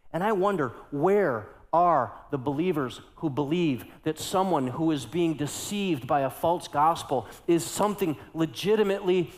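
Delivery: ordinary talk, nothing marked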